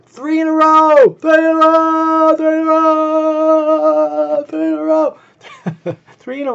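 high, loud and slow mock chant; high, slow mocking chant of the phrase; mock sing-song. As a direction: drag it out high to mock them. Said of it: "trying to make fun of this".

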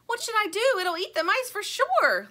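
high and loud with panic; frightened cry. A narrator's reading. Lumpy pleading with Tuk Tuk, high frightened cry. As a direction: cry out in alarm. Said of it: "Lumpy cries".

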